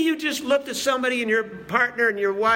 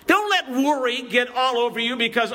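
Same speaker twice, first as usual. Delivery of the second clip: usual speaking voice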